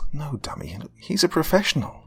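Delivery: the snob correcting her friend, snobbily; snobby. A narrator's reading. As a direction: look down your nose at him. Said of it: "in the snobbiest of tones".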